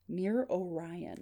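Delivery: slow, soft mysterious caller; faint, measured phone voice; weak, unclear voice. Acted. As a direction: let each word out slowly and faintly. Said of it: "This part is weak and unclear".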